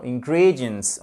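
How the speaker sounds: "ingredients" corrected, stress on GREE; correct English pronunciation. Shown as a demonstration. Teacher correcting learners' mistakes, "ingredients" stressed on the second syllable. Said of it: "c'est pas ingredients ou ingredients".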